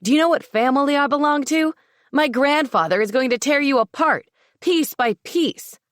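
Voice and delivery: shouted threat. Acted. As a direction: bluster and threaten at full volume. Lewis threatens Thane.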